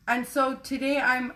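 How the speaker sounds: ordinary delivery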